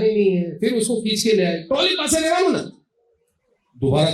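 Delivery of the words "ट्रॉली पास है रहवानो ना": much higher, shrill mock scolding; imitating a mother yelling. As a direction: squeal it like a frantic mother in the shop. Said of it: "mother चिल्ला रही है".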